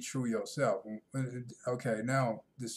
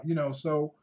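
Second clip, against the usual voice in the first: ordinary delivery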